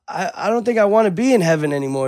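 high, exasperated retold complaint; fed up. Gambler telling his guide he has had enough, exasperated. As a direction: complain high, acting out someone at his limit.

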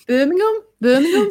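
high, questioning Brummie accent; Birmingham accent. Shown as a demonstration. Host showing co-host how it sounds, exaggerated Birmingham accent. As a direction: show off a hammed-up Birmingham accent. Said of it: "Is that your Birmingham accent?".

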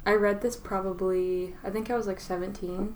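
normal talking voice

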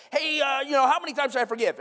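high, loud casual asking; eager advice seeker. Acted. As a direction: act out pestering someone for an answer. Acting out shopping around for answers.

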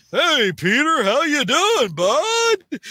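high, bright-voiced jock hello; jock greeting. Flash greets Peter with a high hearty jock hello.

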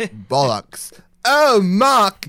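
imitating an actor practising a British accent in his trailer; mock British accent. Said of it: "doing the accent, being like".

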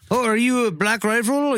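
high, put-on Canadian accent; bad Canadian accent. Imitating a Mountie at the border, bad Canadian accent. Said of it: "I can't do a Canadian accent, but I'll try".

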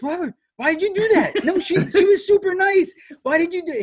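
high-pitched, loud, incredulous retold pleading; dismayed protest. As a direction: protest in comic disbelief, voice shooting way up. Acting out his dismayed protest.

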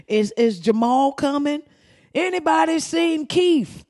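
high, drawn-out call for the name; worried fan calling. Retold or imitated. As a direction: call down the tunnel for the missing player. Fans calling for absent players.

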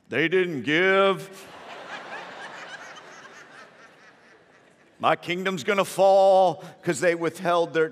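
loud, slow booming lament; hammed-up wail of dismay in a full voice; mock-distressed God. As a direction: draw it out like a ruler facing doom. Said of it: "He probably didn't sound like that".

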